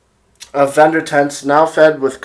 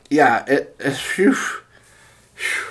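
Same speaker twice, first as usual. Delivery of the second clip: out of breath; 3 dB quieter, slower than usual. Real, not acted. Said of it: "I'm getting out of breath here".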